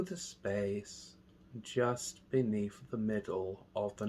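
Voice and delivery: hushed, unhurried guidance, letting each phrase settle; guided meditation. Real, not acted. Meditation teacher guiding listeners through mindful breathing, slow and soft.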